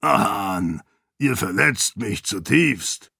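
slow, lightly voiced hammed-up hurt; mock offended uncle. A narrator's reading. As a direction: play wounded, but joking.

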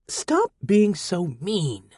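put-on slow high girlish hiss; girl hissing reproach at the sec unit. A narrator's reading. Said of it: "Amina hissed at me".

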